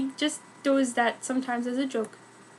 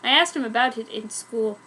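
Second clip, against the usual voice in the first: ordinary delivery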